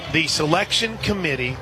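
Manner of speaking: ordinary talk, nothing marked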